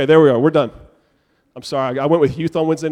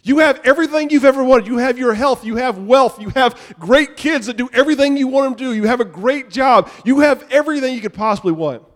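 rising preaching emphasis; 6 semitones higher, 4 dB louder than usual. Real, not acted. Building sermon list.

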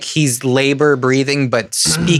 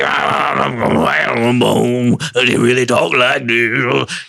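slow, gravelly bluesman talk; much slower than usual. Retold or imitated. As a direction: drag it out like a bluesman. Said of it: "[name]'s talking voice".

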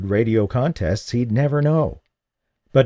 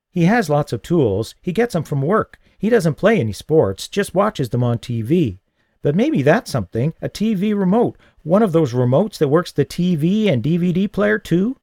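put-on higher, eager kid listing ideas; lightly voiced boy Paul; 7 semitones higher than usual. A narrator's reading. The boy suggesting gifts for his uncle, lightly voiced.

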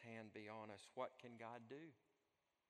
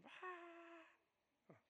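high, softer imitated wail; drawn-out high infant whine; baby's cry; 8 dB quieter than usual. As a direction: mimic a baby starting to cry from a distance. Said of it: "screams, cries, hollering".

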